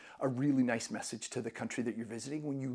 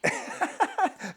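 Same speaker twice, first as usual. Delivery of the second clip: high laugh; 4 dB louder than usual. Real, not acted. Laughing at compliment.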